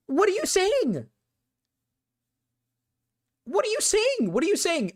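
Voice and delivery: very high, squeaky indignant voice. Real, not acted. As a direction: squeak it out in mock outrage. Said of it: "I'm saying you have a soy voice".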